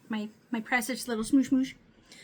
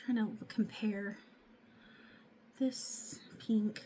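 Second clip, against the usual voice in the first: whispering; 8 dB quieter, slower than usual. Real, not acted. Muttering while comparing makeup colors.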